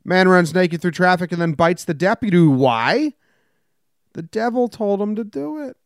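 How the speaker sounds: slow, deliberate newscaster read with deadpan punchlines; news read. Acted. Host reading odd news headlines to his co-host, newscaster style. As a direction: read the headlines like an evening anchor.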